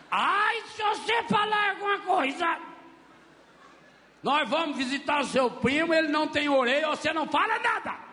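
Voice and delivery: put-on a mother warning her kid, pitched high; threatening mother. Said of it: "beliscão assim na gente".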